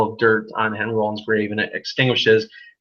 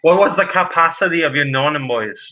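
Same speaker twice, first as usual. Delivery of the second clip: put-on old man voice; 4 semitones higher than usual. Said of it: "I can't do voices".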